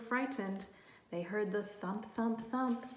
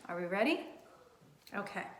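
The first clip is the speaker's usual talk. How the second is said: usual speaking voice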